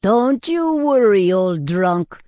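loud, high tavern owner's call; yelling. A narrator's reading. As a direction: yell it across the room, stretching the words. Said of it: "yelled Christos, the tavern owner".

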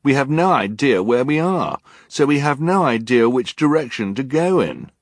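put-on high, loud protesting child; exasperated argument. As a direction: hammer the objection home. Klaus argues with Olaf.

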